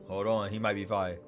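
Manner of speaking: normal talking voice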